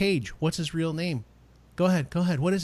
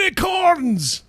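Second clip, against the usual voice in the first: a high, slow Scottish shout of unicorns; Scottish accent; 4 dB louder, slower than usual. Retold or imitated. Scots cheering their national animal, shouted in a Scottish brogue. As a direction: cheer like a tipsy Scot, drawn out. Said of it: "that Scottish accent".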